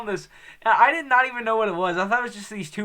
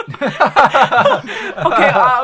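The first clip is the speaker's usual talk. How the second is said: laughing; 5 semitones higher, 12 dB louder, much slower than usual. Real, not acted. Recovering from laughter.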